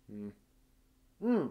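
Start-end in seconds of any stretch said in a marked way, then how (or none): none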